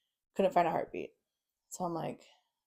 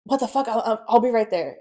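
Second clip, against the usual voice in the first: retold shocked outburst, higher and louder; alarmed father; 5 semitones higher, 7 dB louder than usual. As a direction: imitate a dad urgently blurting it out. Father reacts to news of death.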